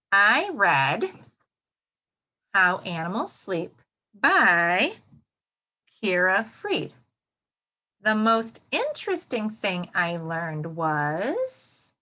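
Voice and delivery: slow, deliberate sentence starters; slow modelled sentence frame. Shown as a demonstration. Teacher modelling the rubric sentences for young students, slow and deliberate. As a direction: model each frame slowly for the class. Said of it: "This is how it will sound".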